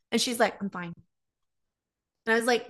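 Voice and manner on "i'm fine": quiet, withdrawn mimicry of mom; soft, closed-off mock reply; imitated sulky dismissal. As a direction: drop the volume, mimicking a sulk. Imitating passive-aggressive mom's dismissal.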